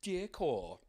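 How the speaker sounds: high-pitched imitation of salon gossip; mocking nail salon workers. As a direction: voice a salon worker sniping about a customer. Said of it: "nail salons are like".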